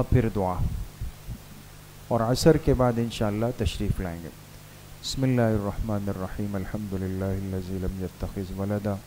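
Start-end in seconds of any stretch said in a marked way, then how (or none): 5.0-9.0 soft, low prayer murmur under the breath; murmured recitation. Acted. Teacher leading students in quiet recitation, murmured softly. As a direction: recite it quietly to yourself, inward.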